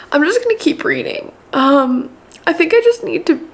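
ordinary talk

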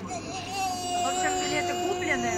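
high, loud and tearful, barely holding it; crying. Real, not acted. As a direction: voice breaks into real tears. Recounts being upset to tears. (плачет, голос срывается)

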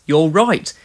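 ordinary delivery, nothing marked

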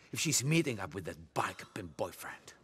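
menacing hushed whisper. Acted. Boss giving Niko a quiet order about the boyfriend, whispering. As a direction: lean in and hiss the order low.

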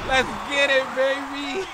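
hyped cheer. Real, not acted. Celebrating a play.

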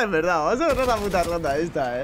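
usual speaking voice

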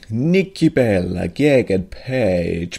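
put-on slow jolly Italian pizza chef accent. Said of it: "Warum rede ich mit italienischem Akzent?". (italienischer Akzent, langsam und vergnügt)

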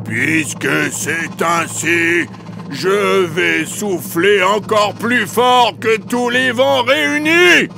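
high, very slow furious threat; big bad wolf in mad fury; raging wolf howl. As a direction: howl the threat, dragging out each word. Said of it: "entra dans une rage folle et poussa un hurlement effrayant".

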